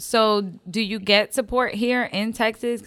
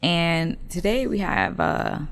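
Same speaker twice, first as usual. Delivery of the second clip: usual speaking voice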